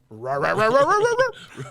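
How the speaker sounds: unintelligible dog-like gibberish soaring very high; Scooby-Doo babble. Retold or imitated. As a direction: imitate garbled speech as a cartoon dog, loud and climbing. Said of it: "it just sounded like".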